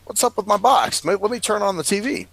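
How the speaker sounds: lightly voiced puzzled musing. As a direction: wonder aloud to yourself. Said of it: "So I'm like".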